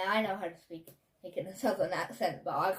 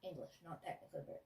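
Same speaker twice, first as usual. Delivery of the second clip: muttered far below the chat; a quiet aside, nearly unvoiced; under the breath; 6 semitones lower, 18 dB quieter than usual. Real, not acted. Co-host correcting her aside, barely audible.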